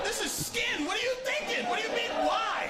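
very high, exasperated yelling; hammed-up outrage at a stupid question; indignant outburst. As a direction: shriek it in comic disbelief. Acted argument, indignation builds.

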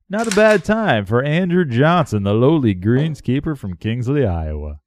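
ordinary delivery

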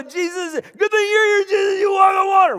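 loud, high, overjoyed call to Jesus; excited shout. Retold or imitated. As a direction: shout it out in giddy relief. Peter's excited cry to Jesus.

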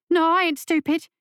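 lightly voiced tough boy; street boy. A narrator's reading. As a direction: snap back like a scared kid. Frightened street boy answers monster.

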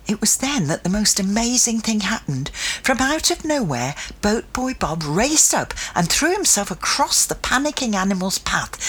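usual speaking voice

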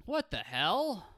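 loud, high "What the hell?"; exclaiming. Real, not acted. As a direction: blurt it out in disbelief. Exclaiming award category name.

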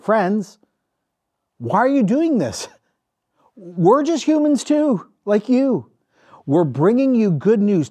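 a half-hammed plea to stop them; mild mock shout. Preacher voicing Paul calling out to the crowd, mock shout. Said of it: "rushed into the crowd shouting".

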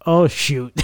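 ordinary talk, nothing marked

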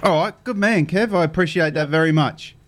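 ordinary talk, nothing marked

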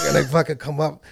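ordinary delivery

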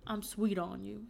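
slow, lightly imitated country accent; attempted country accent. Recalling what the boyfriend would always say, attempted country accent. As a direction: try out a boyfriend's country drawl, not quite getting it. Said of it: "like, a deep country accent".

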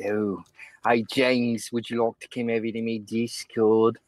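low, hammed-up English accent; mock English accent. Teasing Jings as his leering admirer, mock English accent. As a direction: leer in a put-on English accent. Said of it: "how do you do an English accent?".

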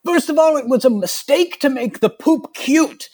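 high, slow, outraged prissy voice; scandalized committee member. Retold or imitated. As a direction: clutch your pearls in a shocked high voice. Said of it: "It's just like".

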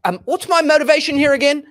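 mock Oxford English actor, climbing high and quick; imitated Oxford thespian, politely interrupting; Oxford actor accent. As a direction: put on a posh Oxford accent that rises as he asks. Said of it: "he's got this perfect Oxford".

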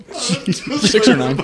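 high, loud, slow answer through laughter; real giggling reply; laughing answer. Real, not acted. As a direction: blurt it out mid-laugh. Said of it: "How big is your spatula".

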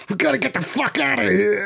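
high, strained escape plea; acted panic. Dragging a friend up the stairs, high and panicked.

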